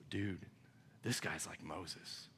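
low, soft amazed murmur; awed hushed crowd. Retold or imitated. Preacher voicing a stunned crowd, hushed awe. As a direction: breathe it like a stunned onlooker.

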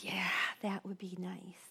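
very soft, near-whispered answer; imitating a quietly wistful cashier; hushed reply.

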